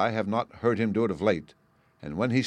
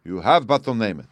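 loud royal grant; king speaking much louder; eager king; 2 semitones higher, 7 dB louder than usual. Acted. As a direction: offer anything loudly. King generous offer.

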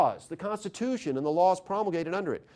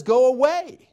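sharp, louder go away, pitched up; firm refusal; 7 semitones higher, 8 dB louder, slower than usual. Retold or imitated. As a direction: push the doctors off with a quick loud dismissal. Patient waking up refuses help.

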